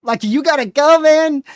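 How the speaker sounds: excited urging. Real, not acted. Streamer urging the storm chaser to drive on, excited. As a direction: hurry him along, excited.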